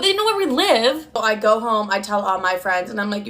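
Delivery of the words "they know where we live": high, startled echo of bad news; mock-horrified rising question; alarmed disbelief. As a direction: act out a shocked question, pitched way up. Acted shock at alarming news.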